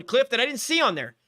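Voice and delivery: normal talking voice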